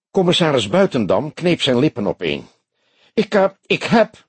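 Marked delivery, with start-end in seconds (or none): 3.1-4.3 high, faltering indignation; high-pitched, flustered chief; voice cracking. A narrator's reading. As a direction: stumble upward into a cracking voice. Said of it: "sprak hij met overslaande stem".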